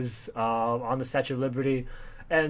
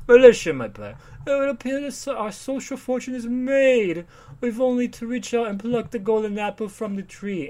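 mock upper-class father; pompous rich man; 8 semitones higher, 4 dB louder than usual. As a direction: ham up a pompous society gent. Voicing pompous rich man from comic.

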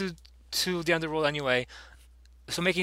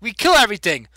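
loud, higher, hammed-up gloating; a big hammy villain brag; mock villain boast; 5 semitones higher, 6 dB louder than usual. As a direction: play the proud killer, loud and higher. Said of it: "He's like".

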